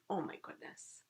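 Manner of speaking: soft, breathy-free whispered awe; hushed wonder. Real, not acted. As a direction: marvel under your breath. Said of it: "Look at this beautiful illustration".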